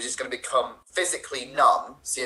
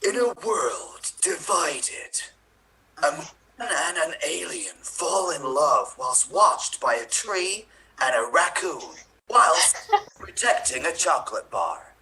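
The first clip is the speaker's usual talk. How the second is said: slow, raised, dramatic trailer narration; movie trailer voice; 4 semitones higher, slower than usual. Acted. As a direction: intone it like an epic film trailer, drawing out each phrase. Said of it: "in your best, uh, trailer voice".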